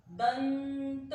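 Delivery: दंत drawn out slowly, short nasal न held before त; slow demo of दंत. Shown as a demonstration. Teacher slowly models दंत while explaining. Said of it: "जब बोला दंत".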